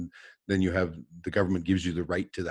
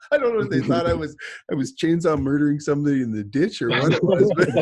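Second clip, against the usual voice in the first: loud, high-pitched talk through laughter; laughing; 8 semitones higher, 7 dB louder than usual. Real, not acted. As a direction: barely hold back the laugh, voice pushed up. Laughing at own story.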